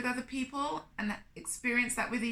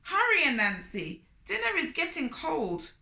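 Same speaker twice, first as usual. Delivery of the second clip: grinning Turtle; 6 semitones higher, 4 dB louder than usual. Acted. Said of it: "grinned Turtle".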